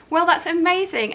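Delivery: high, loud "amazing"; delighted. Real, not acted. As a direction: gush with delight. Reacting to the winner's comment.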